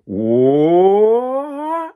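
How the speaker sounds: rising high whoa ringing the room; high swooping whoa; resonant whoa. Shown as a demonstration. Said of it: "So you can go".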